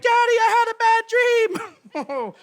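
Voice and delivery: put-on high, slow little-kid whine; sleepy child waking her parents. Child at parents' bedroom door.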